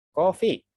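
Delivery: coffee said the English way, KOF-ee; coffee with an English accent. Shown as a demonstration. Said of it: "bahasa Inggris ya".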